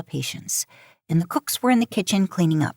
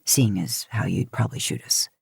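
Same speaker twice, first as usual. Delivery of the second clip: lower, quick, wry man's voice; Dean's voice; 8 semitones lower than usual. Acted. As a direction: tease her lightly in a low voice. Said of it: "Dean said as he took his turn".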